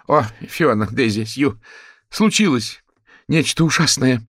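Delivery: high, loud theatrical wail; melodramatic. Acted. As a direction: overplay the drama like a diva. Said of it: "её мелодраматичный тон".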